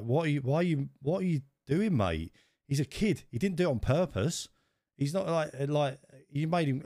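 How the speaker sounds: high, heated telling-off of a grown man; outraged dad confronting another parent, yelling high; retold angry yelling. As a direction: voice jumps up high, furious at the bloke. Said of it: "I started effing and blinding this bloke".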